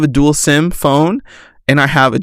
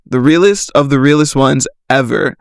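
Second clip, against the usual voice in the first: normal talking voice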